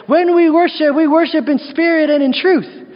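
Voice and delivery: high, loud mock proclamation; proclaimed slogan. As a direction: declare it like a church-banner slogan. Voicing people's loud slogan.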